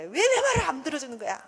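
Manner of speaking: high, loud exasperated complaint; acted annoyed outburst; irritated. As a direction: snap it out, fed up at being ignored. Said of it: "막 짜증을 내".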